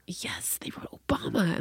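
hushed, whispery insistence; insistent whisper. Retold or imitated. Daughter insisting to mom, whispered. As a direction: whisper it as an imitated kid.

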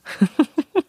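a rising squeaky giggle; quick high laugh. Real, not acted. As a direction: laugh up at the absurdity. Laughing at dad's drive.

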